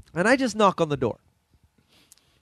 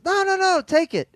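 very high imitated protest; insistent refusal; 14 semitones higher than usual. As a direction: imitate a man hastily refusing, voice way up. Man refusing the offered joint back.

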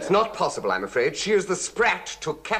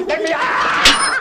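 higher, louder, slower desperate shout; panicked yell; 8 dB louder, slower than usual. Acted. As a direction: bellow it, losing composure. Trapped, calling to get out.

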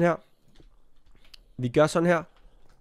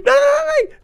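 very high, loud nos; panicked squeal; 8 dB louder than usual. Real, not acted. Wolves attacking. (højt panisk hvin)

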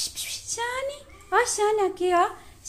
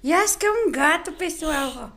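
usual speaking voice

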